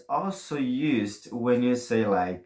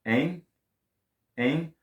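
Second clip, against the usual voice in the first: prodding tag; 5 semitones higher, slower than usual. Shown as a demonstration.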